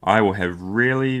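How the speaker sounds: plain reading voice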